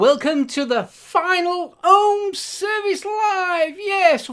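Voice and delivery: loud, high show opener; excited shout. Real, not acted. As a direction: belt out the welcome. Opening the final live show.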